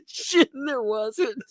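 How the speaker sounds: high, squeaky words through laughter; cracking up mid-sentence; real laughing fit. Real, not acted. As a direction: barely get it out for laughing. Cracking up at own story.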